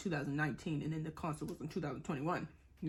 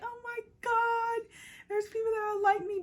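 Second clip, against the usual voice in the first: excited squeal; 4 dB louder than usual. Retold or imitated. Retold thrill of finding similar people.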